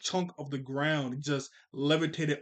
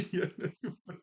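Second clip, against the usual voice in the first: cocky line said through laughter; laughing; 4 semitones higher than usual. Retold or imitated. Repeating War Machine's smug reply, laughing through it. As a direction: chuckle through the words.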